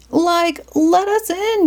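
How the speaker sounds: high panicky shout as Shaggy. Acted. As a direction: yell to be let in, high and frantic. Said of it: "Shaggy shouted".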